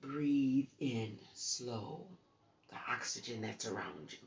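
very slow, drawn out; calm slow guidance; slow breathing demo. Shown as a demonstration. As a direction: stretch each word like a slow breath. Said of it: "take your time".

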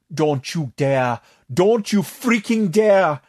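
slow, building to a high, loud cry; beg, then yell it; desperate plea. Acted. Tatok pleading with Bailey not to try the spell, slow and rising to a yell. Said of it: "Tatok moaned uselessly".

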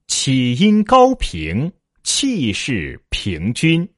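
very slow, drawn-out syllables; read-after-me model. Shown as a demonstration. Teacher leading students in repetition, very slow and measured. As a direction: stretch each syllable for the class to copy. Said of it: "来，跟我读".

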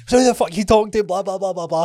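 high, loud challenge to a stranger; hyped-up angry yell. Retold or imitated. Retelling a road-rage row to a mate, high and loud. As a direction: square up and bark it at him. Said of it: "So I get hyped up instantly".

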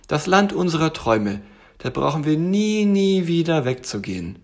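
high, dreamy little tiger; blissful little tiger. Acted. Tiger tells bear they never need leave, blissful. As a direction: swoon over it in a high tiger voice.

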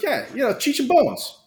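insistent coach. Retold or imitated. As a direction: imitate a coach stating the obvious. Acting coach insisting it is obvious.

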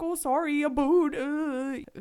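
put-on teasing Canadian accent, lightly done; mock Canadian accent. Americans mocking Canadians in a light Canadian accent. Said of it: "making fun of the Canadian botanists".

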